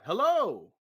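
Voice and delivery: loud call into emptiness. Acted. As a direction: call hello into the empty street. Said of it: "You call out".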